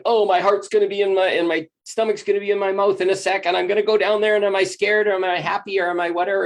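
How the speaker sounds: at the top of the ride, half scared half thrilled; roller coaster thrill. Retold or imitated. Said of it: "or it's like".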